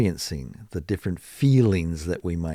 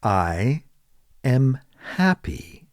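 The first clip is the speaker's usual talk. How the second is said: slow and clear, each word spaced; careful teacher demo; slow model answer; 4 semitones higher, slower than usual. Shown as a demonstration. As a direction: say it slowly for students to copy. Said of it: "you might say".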